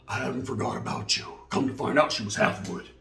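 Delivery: usual speaking voice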